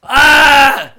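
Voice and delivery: loud, high wordless yell; frustrated yell. Real, not acted. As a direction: let out a loud yell at the flopped joke. Outburst after the joke falls flat.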